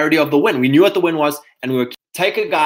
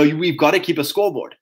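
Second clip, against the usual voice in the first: ordinary talk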